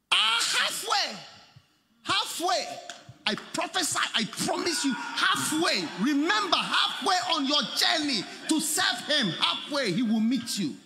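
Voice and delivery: fiery pulpit promise; prophetic preaching. Acted. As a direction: proclaim it like a prophecy.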